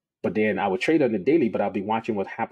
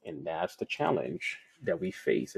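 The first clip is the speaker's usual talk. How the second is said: hushed aside; 7 dB quieter, slower than usual. Real, not acted. Quiet aside to viewers.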